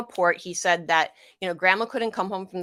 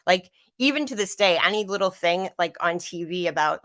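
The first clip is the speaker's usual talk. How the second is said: ordinary talk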